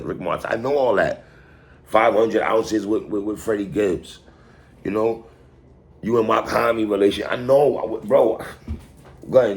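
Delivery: ordinary talk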